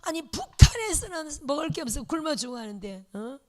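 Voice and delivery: loud, indignant rebuke of the church; elder deaconess loudly scolding the church for eating well; angry scolding. Retold or imitated. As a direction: scold the young pastor's wife loudly, outraged. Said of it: "막 역정을 내는 거예요".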